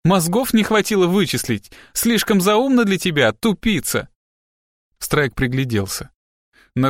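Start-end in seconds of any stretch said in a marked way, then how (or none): 0.0-4.1 high, sneering jibe; venomous scorn. A narrator's reading. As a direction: taunt him, pitched right up. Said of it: "прошипела Пиппа Минджли".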